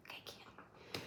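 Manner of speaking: breathy whispered "I can't". Real, not acted. Vlogger giving up on the scene, whispering. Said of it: "whispered aside".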